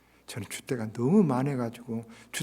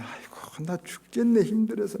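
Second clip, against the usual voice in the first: mock irritated moan, higher; annoyed grumble; 7 semitones higher than usual. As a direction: whine about the heavy load. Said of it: "막 짜증을 내면".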